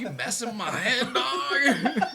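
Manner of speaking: high-pitched, laughing mock outrage; mock incredulous therapist. Acting out his exasperated therapist, laughing.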